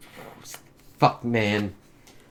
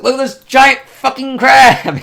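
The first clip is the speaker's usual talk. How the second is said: very high, very loud, slow booming mock delight; gleeful Thor; 12 dB louder than usual. Thor gloating over the monster, hammed-up huge glee. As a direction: ham up a thrilled warrior showing off a crab. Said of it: "He's like".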